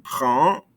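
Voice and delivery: "Brun" left open on the nasal vowel; no N heard at the end of "Brun"; silent ending consonant. Shown as a demonstration. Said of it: "not pronouncing these ending consonants".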